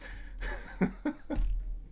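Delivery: high, breathless giggle; real giggle after his own bit; laughing. Real, not acted.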